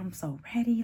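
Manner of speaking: imitated whisper to mom; whispered aside. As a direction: whisper it softly, like confiding in your mother. Retold aside to her mom.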